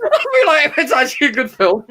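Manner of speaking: laughing. Real, not acted. Echoing friend's line while laughing.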